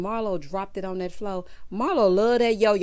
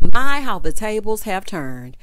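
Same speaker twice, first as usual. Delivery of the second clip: dramatic proclamation; 10 dB louder than usual. Real, not acted. Dramatic reversal comment.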